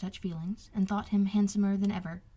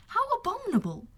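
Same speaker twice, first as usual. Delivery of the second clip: much higher, outraged exclamation; indignant cry; 7 semitones higher than usual. Acted. Elizabeth outraged at Darcy, crying out to Wickham. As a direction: let the indignation burst out.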